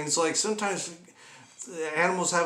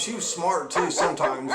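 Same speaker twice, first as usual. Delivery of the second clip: ordinary talk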